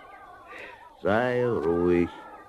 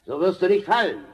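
higher, carrying call from far below; booming call; 12 semitones higher than usual. Acted. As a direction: boom it up to the small man above. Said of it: "rief dröhnend herauf".

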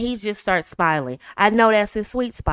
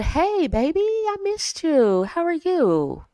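much higher, slow, tender coo; soft loving greeting; 7 semitones higher, slower than usual. Shown as a demonstration. Wife greeting tired husband, slow and tender. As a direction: greet him gently and sweetly. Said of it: "Not appropriate ... But".

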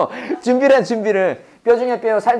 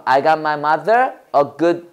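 very slow, each word spaced; slow model sentence; 4 semitones lower, much slower than usual. Shown as a demonstration. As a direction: drill it slowly for the class. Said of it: "해봐".

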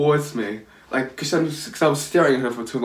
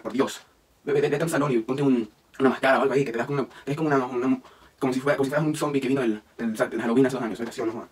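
fast, clipped-free rattled-off Spanish tirade; Spanish insults fired off fast; rapid Spanish insults; 3 dB quieter, much faster than usual. Retold or imitated. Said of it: "started swearing at her in Spanish".

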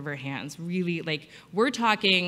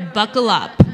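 loud; 11 dB louder than usual. Real, not acted. Narrator challenge to patient.

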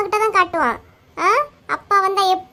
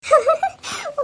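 high gleeful laugh; mocking laugh; 8 semitones higher than usual. Sibling seeing the other flee from father, mocking laugh. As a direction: laugh at someone running away. Sibling mocks the one who fled.

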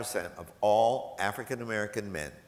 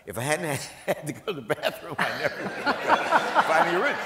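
laughing; 7 semitones higher, 6 dB louder than usual. Real, not acted. Telling the host about the bathroom idea, laughing. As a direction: giggle it out, slowing at the end.

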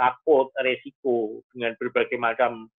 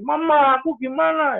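high-pitched mock child's wail; imitated child's plea; crying child; 12 semitones higher than usual. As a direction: whine up high like a kid needing mum. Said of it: "anaknya nangis".

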